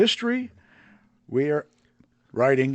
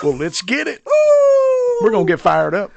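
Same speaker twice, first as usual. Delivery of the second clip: loud, high, fired-up call to the recorder; hype-man shout; 9 dB louder than usual. Real, not acted. Cohost hypes the start of the episode with a loud shout. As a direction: pump the crowd up, shouting.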